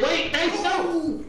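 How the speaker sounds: high slow panicked cry. Real, not acted. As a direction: panic as you slip. Player falling, panic.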